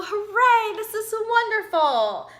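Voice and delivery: high, loud hooray; elated high cheer; joyful cheer. Acted. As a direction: burst out with joy as you fly. Owl flies, cheering.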